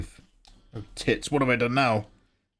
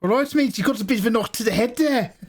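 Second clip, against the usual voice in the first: bright, raised South African voice, lightly put on; a quick South African bloke impression; casual South African accent, higher; 9 semitones higher than usual. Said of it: "I can't do his accent".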